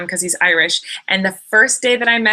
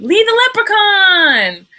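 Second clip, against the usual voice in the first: loud, high, drawn-out excited cry; big stretched-out gleeful reveal; excited announcement; 12 semitones higher, 8 dB louder, slower than usual. Real, not acted. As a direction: belt the name out in delight. Excited reveal of the name landed on.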